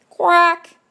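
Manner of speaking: loud, high angry duck quack. A narrator's reading. Stuck duck quacks angrily at the sheep, loud and high. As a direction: blast out a cross duck's quack. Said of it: "the quack of an angry duck".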